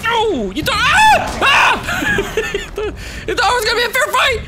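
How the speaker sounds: half gloat, half scream as he gets hit; screaming taunt. Real, not acted. Gamer taunting the boss while getting hit, in a high frantic scream.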